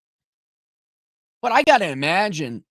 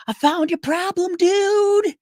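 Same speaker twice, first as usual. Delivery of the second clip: put-on high, cheery American agent accent; upbeat American accent explaining the fix; 11 semitones higher, 3 dB louder than usual. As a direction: play the helpful American rep. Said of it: "in an American accent".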